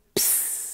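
soft puff of air; breathy vanishing sound; whispered pss. Acted. Narrator sounding the leprechaun vanishing, soft puff.

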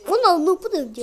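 loud, stunned outcry; shocked shout. Real, not acted. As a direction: yell it in disbelief. Repeats line after getting hit.

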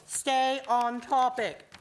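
slow, firm order; a slow, firm telling-off; stern command. A narrator's reading. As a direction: lay down the law. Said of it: "Alice shouted as she banged".

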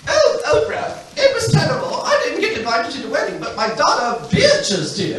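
a high, giggly British duchess gushing to Oprah; Fergie in a posh English accent. Retold or imitated. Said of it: "in the English accent".

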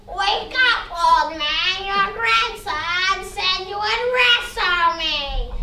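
very slow, drawn-out spooky sprite; water sprite voice. Acted. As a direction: stretch every word like a lake spirit.

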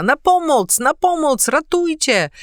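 high, loud panicked cries for help; yelling for help. A narrator's reading. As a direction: scream for help at the intruder. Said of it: "zaczął wrzeszczeć".

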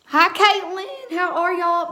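high, slow, drawn-out hello to viewers; high bright greeting. Real, not acted. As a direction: stretch the hi up high and loud. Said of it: "My voice, y'all".

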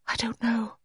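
high, soft near-whisper; hushed, wispy high voice; almost whispered. A narrator's reading. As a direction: barely voice it, pitched high. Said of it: "She almost whispered it".

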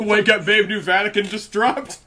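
giggly, high meme announcement; laughing meme voice. Pretend. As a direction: blurt the meme through laughter. Meme quote, laughing, raised voice.

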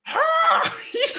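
a very high, loud screeching mock dinosaur roar; T-Rex roar. Host does his own T-Rex roar for the guest, loud screeching roar. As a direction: let out your best T-Rex roar. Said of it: "I'll do mine".

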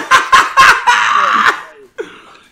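sudden high, loud laugh; a real shrieking laugh; loud burst of laughter. Real, not acted. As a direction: crack up out loud.

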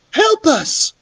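crying for help. Acted. Beatrice cries to the man in the doorway, urgent high plea. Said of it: "Beatrice cried to him".

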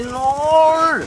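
high, loud cry of despair; dismayed shout. Real, not acted. Gamer yelling as he gets killed, a loud despairing no. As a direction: yell out as the last life goes. Dies in the final round.

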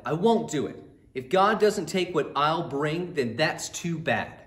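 loud, stubborn shout of refusal; defiant refusal. Acted. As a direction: dig in your heels and shout him down. Cain angrily refuses Abel.

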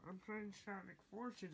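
ordinary talk

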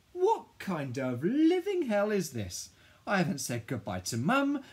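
loud, high wailing outburst; outraged despair. A narrator's reading. Child losing home and mates, loud despairing protest. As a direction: cry out in exasperation.

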